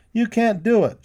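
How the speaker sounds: high, loud protest; exclaiming. A narrator's reading. Boy protesting to the mean cowboy, high exclaim. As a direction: burst out in protest. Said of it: "John exclaimed".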